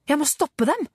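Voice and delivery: high-pitched, urgent inner exclamation; urgent resolve. A narrator's reading. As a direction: think it with sudden urgency. Boy's urgent thought during kidnapping.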